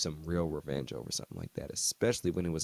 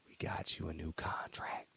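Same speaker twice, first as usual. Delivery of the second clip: hushed confiding whisper; secretive whisper; 10 dB quieter than usual. Retold or imitated. Agent telling Hernandez the contract, hushed whisper. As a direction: lean in and whisper the news.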